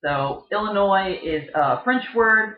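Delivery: ordinary talk, nothing marked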